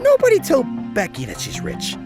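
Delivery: normal talking voice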